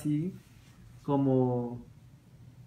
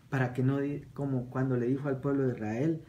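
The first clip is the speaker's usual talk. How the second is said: ordinary talk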